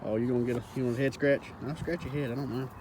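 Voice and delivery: very soft, low murmur; hushed, easygoing pet talk; talking to an animal. Real, not acted. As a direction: speak quietly to the steer beside you. Talking to steer, offering head scratch.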